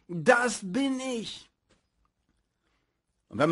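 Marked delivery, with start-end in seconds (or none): 0.1-1.5 sehr langsam, Gewicht auf „bin“; „Das bin ich“ langsam ausbreiten, „bin“ betont. Shown as a demonstration. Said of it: "dass wir sagen". (langsam und betont gesprochen)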